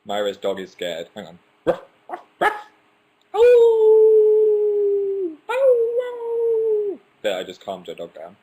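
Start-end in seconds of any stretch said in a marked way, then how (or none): 1.6-2.5 high yappy barks imitating a small dog. Barking to calm a viewer's scared dog. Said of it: "I speak dog". 3.3-7.0 very high loud howling yelps; dog howls. Pretend. Howling like a dog to calm a viewer's dog. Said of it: "I speak dog".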